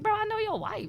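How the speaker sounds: high, sharp reminder; pointed callout. Retold or imitated. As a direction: call out the married man. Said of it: "I was like".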